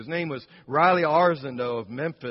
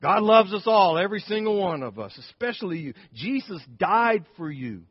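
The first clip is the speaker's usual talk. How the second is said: high, loud heartfelt tenderness; tender assurance; 4 semitones higher, 5 dB louder than usual. Retold or imitated. Grandmother comforting the trembling gunman, loud heartfelt tenderness. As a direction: pour out love on him earnestly. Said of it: "I said".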